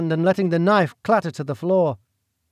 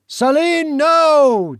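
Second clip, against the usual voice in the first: a high, loud anguished howl; 12 semitones higher, 8 dB louder, much slower than usual. Acted. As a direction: roar after her as she flees. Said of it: "Duke Northcote howled".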